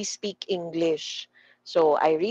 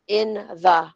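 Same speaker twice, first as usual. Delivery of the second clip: "in the" as two separate, fuller words; careful unreduced take; 4 dB louder than usual. Shown as a demonstration. Teacher contrasting forms for students, "in the" said fully. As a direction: give "in" and "the" each their full length. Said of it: "mabilis lang siya at soft lang siya".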